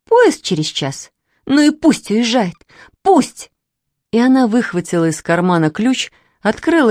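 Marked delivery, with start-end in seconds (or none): 0.1-3.5 hurt defiance rising to a high, loud end; stung, climbing exclamation; indignant outburst. A narrator's reading. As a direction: build to a bitter last cry. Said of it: "закричала девушка".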